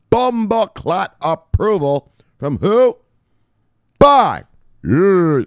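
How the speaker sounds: high, loud, drawn-out hype; hyped shout. Real, not acted. Streamer celebrating the approval to viewers, hyped shout.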